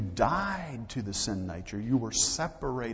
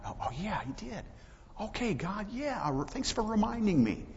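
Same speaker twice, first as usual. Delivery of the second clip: higher, acted-out sudden recollection; dawning realization; 11 semitones higher than usual. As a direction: play someone suddenly remembering. Acts out believer suddenly remembering.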